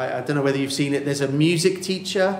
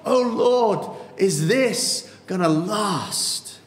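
high, pleading cry of how long; a cry of distress to the Lord; anguished psalmist; 7 semitones higher, much slower than usual. Retold or imitated. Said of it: "here he goes".

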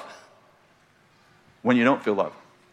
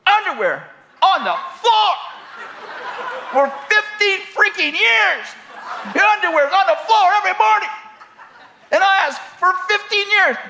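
much higher, hammed-up nagging rant; mock shrill wife; 10 semitones higher than usual. Imitating the wife scolding her husband about underwear, much higher. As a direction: screech the complaint up high like a fed-up wife. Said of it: "the last thing I remember her saying is".

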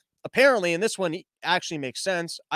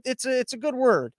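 ordinary delivery

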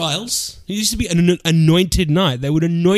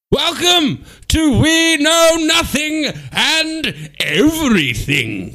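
loud, slow, high announcer intro; podcast intro; 9 semitones higher, 3 dB louder, much slower than usual. Acted. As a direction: boom out the show title. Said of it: "show intro".

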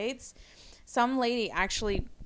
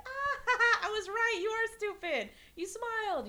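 high, laughing taunt; mocking laugh; 12 semitones higher than usual. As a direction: gloat at the driver with a laugh. Mocking the rude driver.

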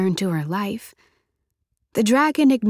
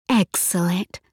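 whispered; 3 semitones lower than usual. Acted. Dragon answering on the phone.

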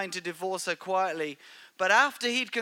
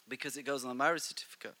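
whispered aside; 7 semitones lower, 9 dB quieter, much faster than usual. Real, not acted. Whispered aside during wedding talk.

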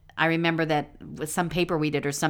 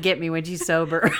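usual speaking voice

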